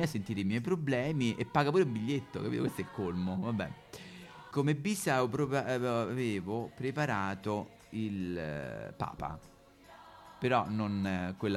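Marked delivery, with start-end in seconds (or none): none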